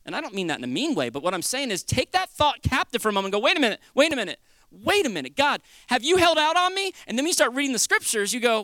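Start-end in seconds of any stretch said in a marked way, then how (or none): 3.5-6.9 questioning protest. Acted. Acted challenge to a doubting thought.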